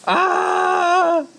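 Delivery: long, high, sustained "aah"; imitated scream. His soul screaming after the crash, mock scream. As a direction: re-enact a drawn-out wail. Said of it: "mi alma empezó a gritar".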